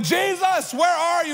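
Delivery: high mock panicked shout; scared disciples. Disciples in the storm shout to Jesus, mock panic. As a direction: cry out like frightened men in a storm. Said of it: "scared and freaking out".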